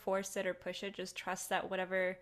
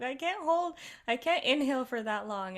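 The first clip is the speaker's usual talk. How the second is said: high, loud mock protest; 5 semitones higher, 8 dB louder than usual. Meditator mimicking own reaction to breath counting, mock protest. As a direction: protest in a raised, squeezed-for-air pitch. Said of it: "And I'm like".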